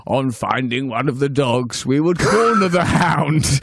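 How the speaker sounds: put-on ponderous old statesman reminiscing; Winston Churchill. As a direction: address the monarch in a mock-grand statesman's voice. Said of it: "That's, uh, Churchill".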